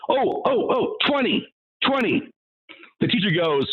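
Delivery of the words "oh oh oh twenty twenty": put-on higher, eager know-it-all kids; mocking eager kids. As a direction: mimic the class shouting the wrong answer. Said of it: "the whole class said".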